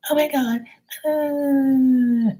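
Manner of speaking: very high mock squeal, drawn out; loud brunch woman. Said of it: "she was so loud".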